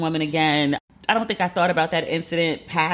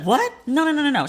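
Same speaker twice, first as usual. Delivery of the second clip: shocked protest; 10 semitones higher than usual. Retold or imitated. Friend reacts to her being kicked out.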